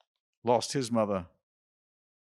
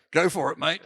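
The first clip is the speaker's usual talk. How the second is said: put-on higher, louder, jokey Australian accent; 7 semitones higher, 5 dB louder than usual. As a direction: say it in your best mock Aussie. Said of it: "in my best Australian accent".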